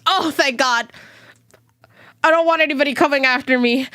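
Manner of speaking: usual speaking voice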